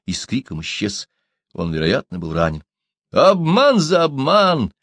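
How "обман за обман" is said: high, slow, loud cry; drawn-out shout of revenge; outcry. A narrator's reading. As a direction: cry it out in triumph. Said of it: "вскрикнул китаец".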